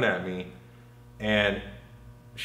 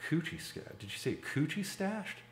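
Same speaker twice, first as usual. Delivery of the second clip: hushed disbelief; 8 semitones higher, 11 dB quieter than usual. Retold or imitated. Shocked inner thought, retold.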